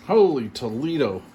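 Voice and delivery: ordinary delivery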